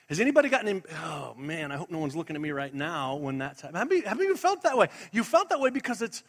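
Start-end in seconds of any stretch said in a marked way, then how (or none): 1.0-3.8 quick, low, hushed worry; squirmy. Acted. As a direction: imitate a squirming churchgoer under their breath. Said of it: "Just kinda got squirmy".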